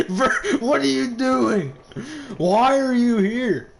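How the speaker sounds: loud, high disbelieving calls; loud incredulous shouting at a teammate; incredulous yelling. Real, not acted. Caster yells at camping player.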